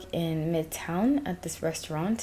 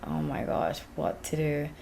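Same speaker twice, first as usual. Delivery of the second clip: retold under-the-breath reaction, soft and breathy; half-whispered mock dismay; muttered dismay; 3 semitones lower, 6 dB quieter than usual. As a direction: mutter it quietly, as if to yourself. Retold inner reaction to yelling.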